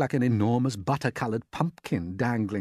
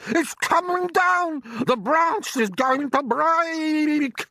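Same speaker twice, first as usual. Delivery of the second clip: a much higher, louder put-on aunt's voice, excited; Aunt Sponge; 8 dB louder, much slower than usual. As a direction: play the greedy aunt gaping at the peach. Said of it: "Aunt Sponge shouted".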